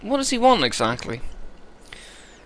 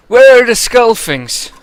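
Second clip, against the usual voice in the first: slow, much louder West Country demand; menacing West Country Eirik; 11 semitones higher, 10 dB louder, slower than usual. Acted. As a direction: loom over him and demand an answer. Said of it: "I think West Country for this guy".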